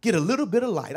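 rising, shouted preaching over the organ; full-force preaching. Acted. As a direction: build it up, pleading for light.

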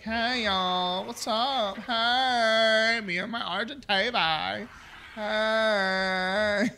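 high, hammed-up dude greeting; goofy character voice. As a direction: chirp it like a cartoon buddy. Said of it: "He's like".

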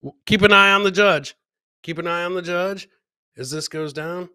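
usual speaking voice

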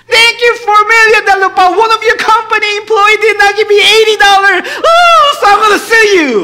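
very high, loud mock outrage; hammed-up indignant shouting. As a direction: yell the complaint at full volume. Acts ungrateful borrower threatening to sue.

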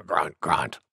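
low boar grunts spoken as words; grunting wild boar. A narrator's reading. Wild Boar joining the sneeze contest, low grunting voice.

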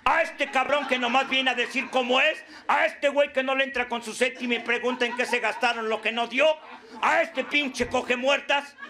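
lightly acted Pilate, pitched a little up; Pilate addressing the crowd. Pilate asks the group whom to save, lightly acted mock orator. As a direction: put each candidate to the crowd as mocking questions. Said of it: "Cuando el Pilatos dijo".

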